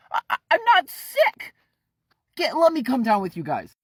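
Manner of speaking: very high, loud stammering plea; frantic Kent. Acted. Kent pleading to come down to the cellar, shrill panic. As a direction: beg in a rising panic.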